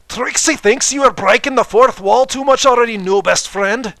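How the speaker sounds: enunciated. Acted. Trixie scolding the fourth wall, over-enunciated. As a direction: pronounce every syllable grandly. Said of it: "Trixie enunciated".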